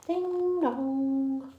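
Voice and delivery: ordinary delivery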